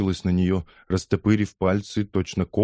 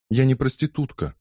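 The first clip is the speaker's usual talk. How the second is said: indignant; 6 semitones higher than usual. A narrator's reading. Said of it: "возмутилась Лиза".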